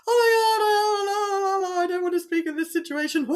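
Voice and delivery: high, loud panicked wail; hammed-up frantic babble, fast and shrieky; mock panic. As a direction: wail in exaggerated panic, far above normal pitch. Acting out an adrenaline-flooded brain.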